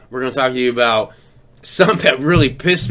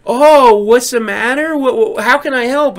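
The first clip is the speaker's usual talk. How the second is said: sweet scammer imitation; 8 semitones higher, 4 dB louder than usual. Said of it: "they're like".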